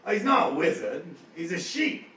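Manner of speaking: loud, high orc correction; orc Guz. Acted. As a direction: insist like a dim orc. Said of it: "Guz looks at you and goes".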